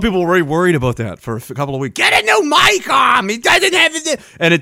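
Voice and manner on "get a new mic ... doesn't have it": high, slow mock shout of nagging viewers; mocking whiny viewers. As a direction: squeal it out like a nagging crowd. Mocking viewers' mic complaints.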